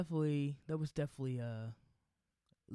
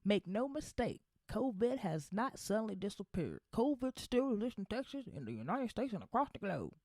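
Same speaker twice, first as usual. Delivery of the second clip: higher, pompous goofy politician; dumb politician voice; 8 semitones higher than usual. Retold or imitated. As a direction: ham up a folksy pandering governor. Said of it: "dumb, goofy, racist politician voice".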